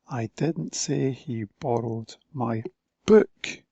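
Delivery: slow build, "book" hit hard; stress on book. Shown as a demonstration. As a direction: punch the final "book". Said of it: "different emphasis on different words".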